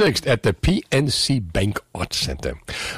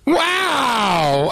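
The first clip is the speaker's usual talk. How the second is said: impressed shout; 8 semitones higher than usual. Real, not acted. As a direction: shout it out in real amazement. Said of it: "And you're Irish, doing a Brooklyn accent".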